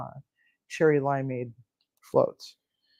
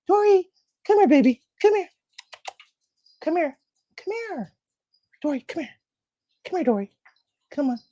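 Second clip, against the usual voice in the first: much higher, sing-song coaxing; high coaxing pet voice; 10 semitones higher than usual. Real, not acted. Coaxing Dory to come to her, high sing-song pet voice. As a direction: coax the shy dog over sweetly.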